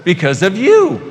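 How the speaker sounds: light up as if just realizing it; a stranger suddenly inspired, brightly; imitated delight. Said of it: "They actually know".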